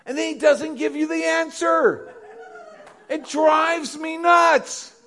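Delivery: high, loud laughing shout; laughing exasperation. Real, not acted. Pastor tells the church God never answers, high loud laughing shout. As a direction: laugh it out, fed up but delighted.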